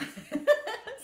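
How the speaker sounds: high loud laughing burst; real happy laugh; burst of laughter. Real, not acted. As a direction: laugh out delightedly. Laughs at a comment.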